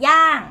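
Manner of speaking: ย่าง with the pitch falling through the vowel. Shown as a demonstration. Teacher showing the tone mark, falling tone on ย่าง. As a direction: draw out ย่าง and let it drop. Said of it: "You become falling tone".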